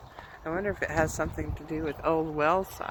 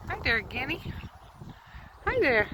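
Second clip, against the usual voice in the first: pet talk; 8 semitones higher than usual. Real, not acted. Greeting the guinea fowl.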